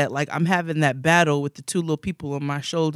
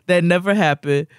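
usual speaking voice

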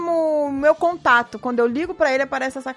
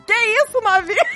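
higher, teasing mock flirt; mock flirty husband; 7 semitones higher than usual. Wife imitating husband's flirty reply, higher. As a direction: play him waking up flirty. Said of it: "eu só vejo ele respondendo".

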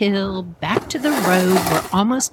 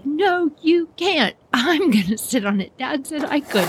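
bratty brother retort; 7 semitones higher than usual. Acted. Brother refusing to share the toboggan, high bratty retort. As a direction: argue back like a kid, pitched up. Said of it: "Johnny goes".

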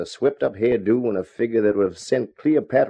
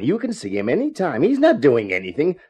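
mocking her phone words; 5 semitones higher than usual. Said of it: "That's a fine way to impress anyone".

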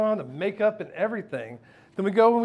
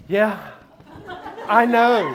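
louder, higher, laughing cockiness; cocky reply; 4 semitones higher, 6 dB louder, slower than usual. Retold or imitated. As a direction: toss it off, smug and pleased.

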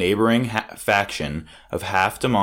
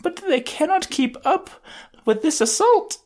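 put-on very high, timid anime girl voice; shy anime girl. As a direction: squeak it like a bashful anime girl. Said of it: "crept forward ... shy girls do in anime".

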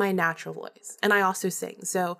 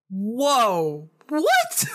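jumps way up in disbelief, louder; a stunned, squeaky whoa what; shocked whoa; 3 semitones higher, 4 dB louder than usual. Retold or imitated. Shocked reaction to the caller.

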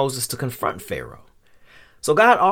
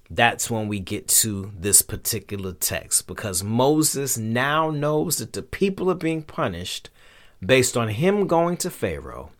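ordinary talk

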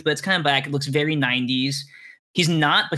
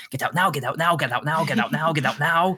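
put-on urgent hushed British accent, repeated; a British voice calling through the door, hushed. Enda urging the group out through the door, in a British accent. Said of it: "he has a British accent".

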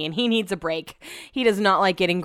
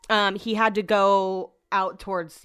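ordinary talk

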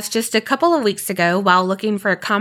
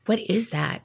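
softer, mock-nervous question; hushed alarm; 2 semitones lower, 7 dB quieter than usual. Telling co-host about hearing Marley scratching, mock-nervous hush. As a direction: lightly act out a scaredy-cat hush. Acting scared at pet's scratching.

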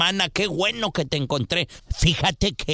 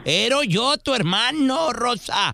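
croaky put-on old man on the phone; a lightly voiced old grandpa pretending to be her brother; 4 semitones higher than usual. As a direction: play a little old man calling his sister. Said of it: "como voz de viejito".

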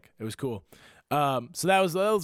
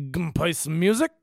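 put-on slurred drunk bar regular, a little louder and higher; 3 semitones higher, 5 dB louder than usual. Drunk stranger interrupting two people setting up for a gig, drunken slur. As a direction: imitate a drunk calling out from behind. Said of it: "a regular who's just piss drunk".

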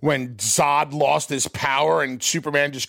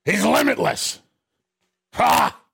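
Vince McMahon; 3 semitones higher than usual. Acted. Boss dictating a catchphrase to staff, bombastic impression. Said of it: "That's Vince".

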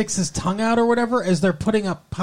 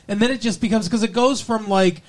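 usual speaking voice

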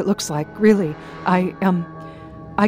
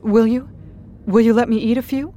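loud, high eager plea; eager begging; 9 semitones higher, 10 dB louder than usual. Acted. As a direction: beg hungrily.

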